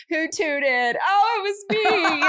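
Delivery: put-on high, rising little-kid voice; a toddler owning up cheerfully; Winter. Mum voicing daughter Winter.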